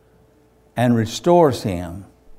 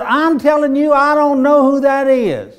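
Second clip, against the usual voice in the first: desperate denial; 13 semitones higher, 6 dB louder than usual. Acted. Peter denies again, louder.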